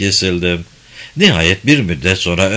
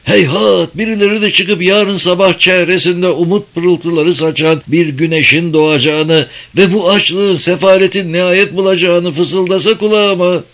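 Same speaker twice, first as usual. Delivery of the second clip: higher, lamenting reproach; reproachful; 7 semitones higher, 3 dB louder than usual. Acted. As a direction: sigh out a bitter wish. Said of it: "Sesindeki perdeyi yükselterek devam etti sitemkar".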